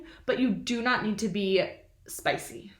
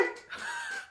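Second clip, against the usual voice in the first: high laugh; 13 dB quieter than usual. Real, not acted. Laughing after silly voice.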